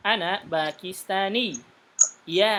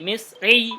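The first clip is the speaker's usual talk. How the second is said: Misri louder with the end pushed up; raised Misri; 5 semitones higher, 7 dB louder than usual. Shown as a demonstration. Teacher demonstrates the raised tone on Misri for students. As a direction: lift the voice on Misri. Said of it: "ditinggikan suaranya".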